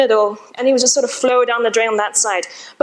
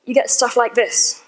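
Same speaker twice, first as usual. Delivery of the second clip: usual speaking voice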